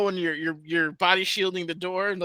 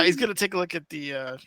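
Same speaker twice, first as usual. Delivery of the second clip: ordinary talk, nothing marked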